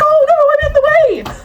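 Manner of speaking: high, whiny mock stranger; high whiny mockery; mock dithering voice. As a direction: mimic a helpless dithering girl.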